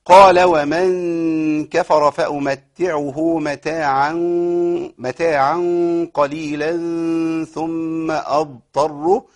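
very slow melodic tajweed chant; Quran recitation. Shown as a demonstration. Teacher chanting the verse to show the stops, slow recitation. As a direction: draw every vowel out in recitation.

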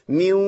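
ميو, noon merged into a nasal waw; idgham demo. Shown as a demonstration. Teacher repeating the idgham example. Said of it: "بقول إيه؟".

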